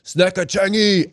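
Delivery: high, drawn-out heckler's call; mock yell. Someone in the crowd yells out, mock yell. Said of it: "just yelled out".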